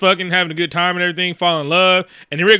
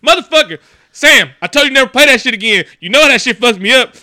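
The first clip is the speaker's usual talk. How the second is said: higher, louder hammed-up rage; mock angry yell; 7 semitones higher, 6 dB louder than usual. Rick storming in yelling at Sam over the song, hammed-up angry yell. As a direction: burst in yelling at him, mock furious.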